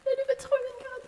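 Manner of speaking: high French voice, quick; French accent, fast. Pretend. As the French smoke detector again: fast high French accent. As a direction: rattle off "Le loup fait trop bien grade" fast and high. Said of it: "French accent".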